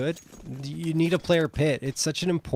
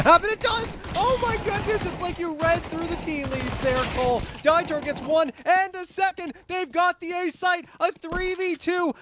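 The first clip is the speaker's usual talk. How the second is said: excited play-by-play; 12 semitones higher than usual. Real, not acted. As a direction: yell the play as it happens. Caster erupts at big play.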